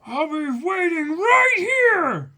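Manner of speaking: high, slow, yelled defiance; long drawn-out shout of defiance; shouted character line. Acted. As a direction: belt it out like a stubborn hero. Reading Luffy's manga line.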